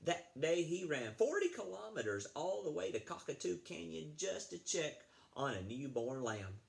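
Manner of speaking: ordinary delivery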